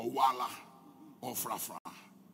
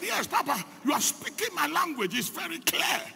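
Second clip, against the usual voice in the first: high, eager and louder; excited answer; 12 semitones higher, 4 dB louder than usual. Retold or imitated. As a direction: burst out in amazed delight. Ramios excited, pastor speaks his language.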